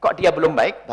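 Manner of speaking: ordinary delivery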